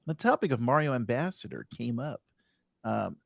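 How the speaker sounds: normal talking voice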